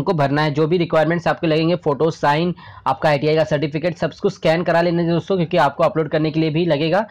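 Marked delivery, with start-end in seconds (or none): none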